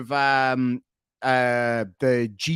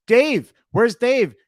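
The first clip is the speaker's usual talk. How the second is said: calling out; 7 semitones higher, 7 dB louder, much slower than usual. Real, not acted. Calling out for Dave.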